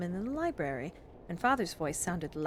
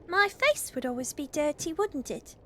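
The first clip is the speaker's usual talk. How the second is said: put-on high little-girl voice, mildly unenthusiastic; unemotional; 8 semitones higher, 5 dB louder than usual. A narrator's reading. Phyllis answers Father about driving engines, unenthusiastic. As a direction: pitch it up, a reluctant child. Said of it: "in an unemotional tones".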